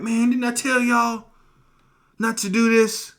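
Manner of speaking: high, loud acted reproach; exasperated scolding. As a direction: scold them like a fed-up brother. Preacher acts Reuben scolding his brothers.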